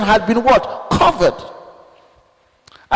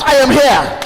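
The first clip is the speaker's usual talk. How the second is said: bold shouted announcement; 2 semitones higher, 4 dB louder than usual. Shown as a demonstration. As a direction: shout it out, fearless. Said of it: "You announce".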